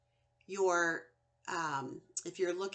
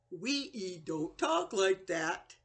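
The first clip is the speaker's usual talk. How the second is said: ordinary talk, nothing marked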